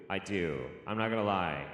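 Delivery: normal talking voice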